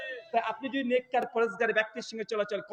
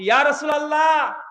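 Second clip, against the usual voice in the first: loud, higher shout; 5 semitones higher, 8 dB louder than usual. Retold or imitated. Companion shouting to the Prophet on battlefield, loud shout. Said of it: "চিৎকার দিয়ে বলছে".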